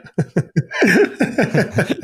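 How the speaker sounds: high, real laughing burst; burst of laughter. Real, not acted. Cohost asks which buck, a real high laugh. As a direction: crack up laughing.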